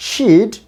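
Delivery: she'd as a drawn-out "sheed"; long vowel. Shown as a demonstration. Teacher says both contractions sound alike, long vowel. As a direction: hold the ee in she'd. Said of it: "দুইটাই long sound উচ্চারণ হবে".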